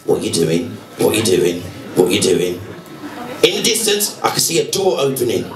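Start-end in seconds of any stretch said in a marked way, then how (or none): 0.0-2.6 low, repeated what you doing; an annoyed producer low in his ear; deep urgent producer voice. Retold or imitated. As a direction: grill him low and insistent.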